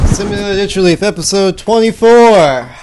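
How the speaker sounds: show announcer. Acted.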